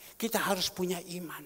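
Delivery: normal talking voice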